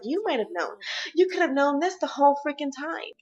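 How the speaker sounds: mock his line with rising anger; angry echo. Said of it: "And she's like".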